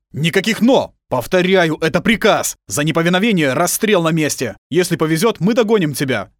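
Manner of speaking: loud, high, no-argument command; stern shout. Acted. Lieutenant overruling the girl, shouting. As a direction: cut her off and order her away.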